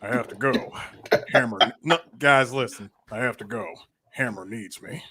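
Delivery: T-Hawk voice. Retold or imitated. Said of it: "voicing T-Hawk leaving".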